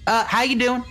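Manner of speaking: a hint of a Texan relative at Thanksgiving; nosy Texan relative. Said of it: "sounds like my Texan relatives I've met at Thanksgiving".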